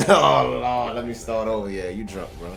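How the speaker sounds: loud laughing through the words; laughing loudly. Real, not acted. Laughing at drunk man's retry.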